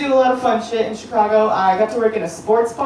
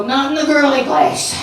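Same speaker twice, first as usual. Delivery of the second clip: repeating his line; 4 semitones higher than usual. Retold or imitated. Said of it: "He'd look at me in my face and go".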